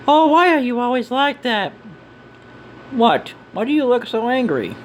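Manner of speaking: high-pitched needling voice; bickering characters. Acted. Companions bickering over an angry look, high character voices.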